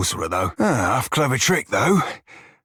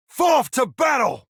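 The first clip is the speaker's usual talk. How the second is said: much higher, roared charge; full charging roar; 12 semitones higher than usual. Acted. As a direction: scream the charge at the enemy. Battle cry at full strength.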